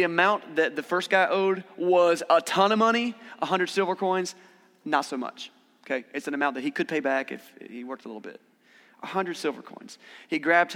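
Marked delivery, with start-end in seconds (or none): none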